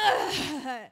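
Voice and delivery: loud mock grunt; imitated gym grunt. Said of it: "guys who will sit around me and just grunt".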